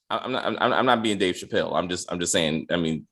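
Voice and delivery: ordinary delivery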